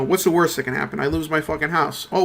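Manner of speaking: ordinary delivery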